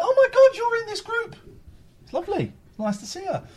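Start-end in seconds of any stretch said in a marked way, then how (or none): 0.0-1.3 much higher and louder, delighted shriek; excited squeal. Retold or imitated. Host retelling spotting Faith in a group, a high excited squeal. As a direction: squeal with joy at seeing a friend. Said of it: "I went".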